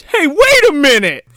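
high, loud cartoon double-take; cartoonish realization. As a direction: say it like Bugs Bunny catching on. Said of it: "He goes".

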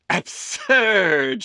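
high, loud doting mother voice; a fussing mother teasing her son; Amelie's voice. A narrator's reading. Mother serving her son lunch, high and fussing. Amelie dismisses weight worry.

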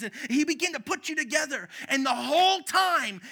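high, loud, fervent preaching voice. Acted. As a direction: preach it out with full fire to the congregation. Preaching about God making you.

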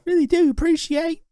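very high, hammed-up Cockney; Cockney accent. Shown as a demonstration. Thanking the co-host in jest, exaggerated Cockney. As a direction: put on a silly high Cockney voice. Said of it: "I do my Cockney accent".

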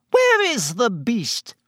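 high, drawn-out reedy demand; reedy official. A narrator's reading. Committee member looks for the missing beast, reedy and high. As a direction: demand it in a piping voice. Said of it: "said the reedy voice of the committee member".

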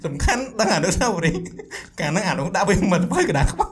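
talking through real laughter; laughing. Real, not acted. Recalling a school memory with a friend, laughing.

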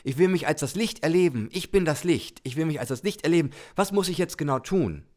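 high, eager burst of joy; a little soul that can hardly wait; full of joy. Pretend. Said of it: "ist voller Freude und sagt".

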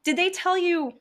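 high, loud sarcastic questioning; mock-sweet confrontation. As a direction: needle the boss with feigned interest. Acted confrontation with her boss.

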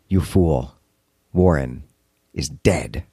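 low, ominous unknown voice on the phone; deep, measured otherworldly reply; indescribable voice. Acted. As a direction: drop deep and let each phrase land after a pause. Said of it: "the response from an indescribable voice is simply".

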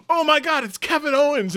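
high, loud pretend fan squeal; hammed-up excited recognition; starstruck fan. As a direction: gush like you just spotted a celebrity. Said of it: "see if people are like".

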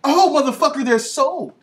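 loud, high triumphant outburst; excited shout. Real, not acted. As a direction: crow about the sale. Boasting his toys sold.

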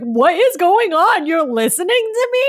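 high, loud disbelief; incredulous shock. Retold or imitated. Speaker recalls her friend suddenly admitting fault, incredulous shock. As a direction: exclaim it in astonished high pitch.

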